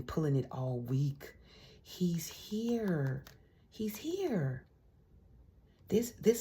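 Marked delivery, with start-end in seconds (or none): 1.8-3.2 whispered, falling at the end; dramatic whisper. Real, not acted. To the viewer, revealing the man in the cards: an eerie whisper. Announcing the Emperor card's arrival. 3.7-4.6 higher, voiced and thrilled; excited reveal. Real, not acted. To the viewer, confirming he is coming: a thrilled, higher call.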